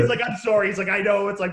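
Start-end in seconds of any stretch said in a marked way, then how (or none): 0.2-0.7 high, loud retold apology; mock apologetic, pitched up. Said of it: "He was, like, apologetic". 0.9-1.3 high, loud sympathetic quote; mock apologetic and emphatic. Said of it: "He was, like, apologetic".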